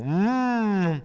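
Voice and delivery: long, high mock moan; mock pleasure moan. A narrator's reading. Andrea mocking his friend's album choice, mock pleasure moan. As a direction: draw out a high hummed moan of fake pleasure. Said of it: "mimò un chiaro gesto di autoerotismo".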